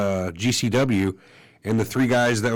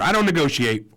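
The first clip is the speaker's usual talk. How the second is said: higher-pitched, half-hearted mock yell; retold yell; 5 semitones higher than usual. Promoter leaving at the airport, lightly mimicked yell. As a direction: repeat the parting shout in a lighter imitation. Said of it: "and yells".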